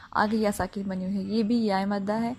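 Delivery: ordinary talk, nothing marked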